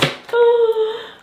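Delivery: sharp, high excited gasp; delighted gasp. Real, not acted. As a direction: gasp at how pretty they are. Unboxing shoes, sudden delight.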